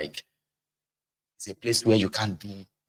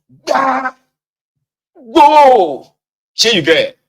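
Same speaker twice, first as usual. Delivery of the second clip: furious shout; 14 semitones higher, 12 dB louder, much slower than usual. Retold or imitated. Parent beating a child.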